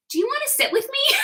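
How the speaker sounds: very high, soft, quick invitation; coaxing hostess. Retold or imitated. Hostess to shy guest in the corner, mock coaxing. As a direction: coo it as if to a scared little child.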